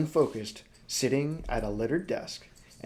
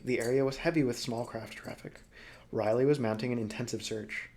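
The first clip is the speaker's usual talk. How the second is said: normal talking voice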